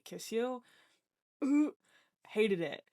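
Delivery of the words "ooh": disgusted ooh. Real, not acted. Remembering kissing guys with disgust.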